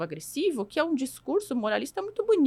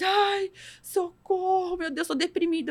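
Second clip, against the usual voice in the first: mock distress; 6 semitones higher, slower than usual. Mimics someone hitting first obstacle.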